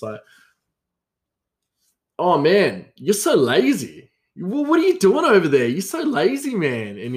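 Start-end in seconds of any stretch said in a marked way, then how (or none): 2.2-7.0 high-pitched mock scolding; teasing. Joking with coworker about being lazy, high teasing. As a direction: rib a workmate in an exaggerated high whine.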